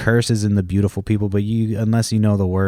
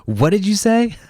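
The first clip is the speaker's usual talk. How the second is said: high-pitched mock questioning; much higher, acted-out demand; sharp challenge; 12 semitones higher than usual. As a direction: imitate someone catching a mutterer out. Imitates being caught muttering a curse.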